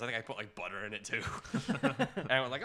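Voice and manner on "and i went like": ordinary talk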